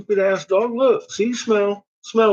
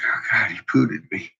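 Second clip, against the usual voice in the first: scared dog's voice; 9 semitones lower, 5 dB quieter than usual. Acted. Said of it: "He's like".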